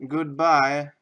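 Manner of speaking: a sudden loud, cheery goodbye. Real, not acted. Vlogger signs off to his viewers with a loud goodbye. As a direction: blast out the farewell to the camera.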